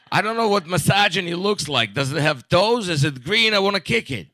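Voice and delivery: a mock-eager, bumbling activist; clueless activist. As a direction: play an idiot questioning strangers on the street. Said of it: "going on the street like an idiot".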